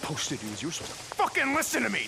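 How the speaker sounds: high, loud furious scolding; shouting at a reckless partner; angry yelling. Acted. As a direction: chew him out at full volume. Quarrel after he fired weapon.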